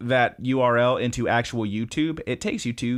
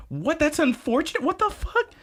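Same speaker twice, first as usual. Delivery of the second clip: shrill, much higher mock-outraged mom, rising. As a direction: climb ever higher in disbelief. Mom reacts in show recap.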